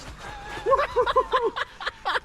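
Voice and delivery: big burst of laughter. Real, not acted. Laughs after a mate's jump.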